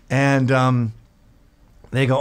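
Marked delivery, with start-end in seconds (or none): none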